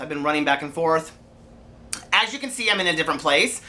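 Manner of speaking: ordinary talk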